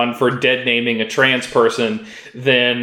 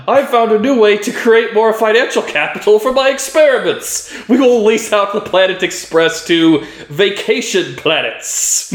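Futurama professor; 8 semitones higher than usual. Acted. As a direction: announce the scheme like a cheerful mad scientist. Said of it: "side plots in a Futurama episode".

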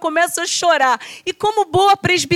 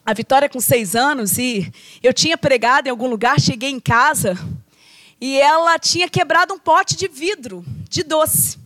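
ordinary talk

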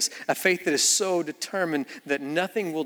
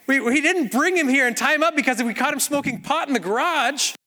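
usual speaking voice

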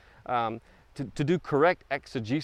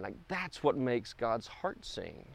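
soft, slow, much quieter, savoring each word; tender hush; 8 dB quieter, slower than usual. Real, not acted. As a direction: let it fall to a tender near-whisper. Preacher savoring God's joy.

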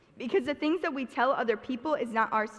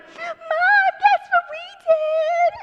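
loud, very high squealed tattletale voice, drawn out; mock little brother; 10 dB louder, much slower than usual. Little brother snitching to mom, exaggerated squealing kid voice. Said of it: "he doesn't talk like this".

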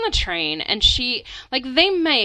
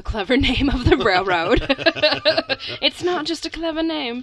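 high mock English accent; playful English accent; 6 semitones higher than usual. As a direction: put on an English accent, pitched up, amused. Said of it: "I'm doing an English accent".

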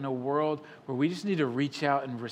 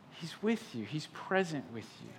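hushed; 9 dB quieter than usual. Real, not acted. Quiet reassurance after laughter.

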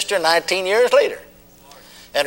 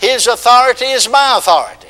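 preaching call; 7 semitones higher, 4 dB louder than usual. Acted. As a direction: chant it out line by line. Call and response.

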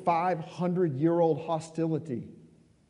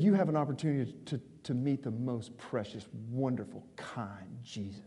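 tender reassurance; 5 semitones lower, 6 dB quieter than usual. Retold or imitated. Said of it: "I said".

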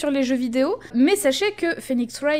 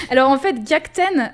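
laughing burst; 2 semitones higher, 4 dB louder than usual. Real, not acted. Laughs after flex remark.

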